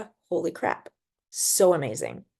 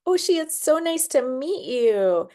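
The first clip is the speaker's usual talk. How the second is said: sweet greeting; 7 semitones higher than usual. Retold or imitated. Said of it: "And I was like".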